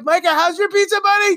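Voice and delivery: high and loud hyped question; amped-up shout. Retold or imitated. Dad hyping his son at dinner, high and loud. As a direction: call across the table all amped up. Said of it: "I'm like".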